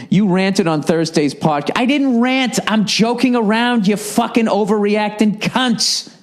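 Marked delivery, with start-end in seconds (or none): 1.8-6.1 higher, heated denial, slowing into the insult; indignant retort. Real, not acted. Host snapping back at a listener's email, indignant and heated. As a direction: push back hard at the critic.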